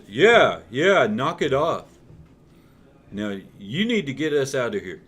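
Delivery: loud, echoing backup shout; the injured cop chiming in; beat-up cop sidekick. Acted. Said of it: "And he goes".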